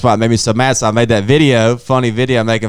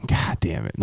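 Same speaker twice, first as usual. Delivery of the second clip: muttered curse; 5 semitones lower, 10 dB quieter than usual. Retold or imitated. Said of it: "I was like".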